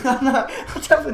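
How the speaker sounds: half-laughing at himself while insisting he's not ugly. Real, not acted. Vlogger laughing to viewers about his looks, laughing loudly.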